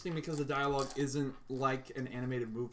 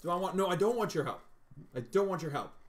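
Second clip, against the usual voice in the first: loud stammering repeat, higher pitch; bad acting; 6 semitones higher, 5 dB louder than usual. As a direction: cut himself off, then repeat it louder. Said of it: "the Shia LaBeouf penguin goes".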